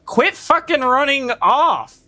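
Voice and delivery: high, drawn-out frustrated complaint; exasperated yell. Real, not acted. Player telling a fleeing enemy off, exasperated.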